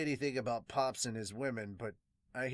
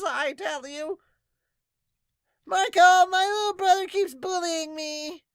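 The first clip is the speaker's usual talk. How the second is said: high, loud whiny villager voices; villagers pestering Marco; 13 dB louder, slower than usual. Acted. Villagers begging Marco for help, high and whiny. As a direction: pile on the needy complaints.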